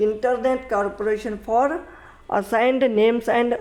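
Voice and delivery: very slow, each word separated; slow teacher dictation; slow full form. Shown as a demonstration.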